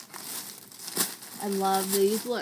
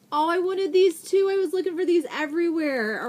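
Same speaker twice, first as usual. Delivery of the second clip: higher and louder, bursting with delight; excited gush; 7 semitones higher, 7 dB louder than usual. Real, not acted. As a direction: gush over the cards you finally found. Thrilled at the index cards.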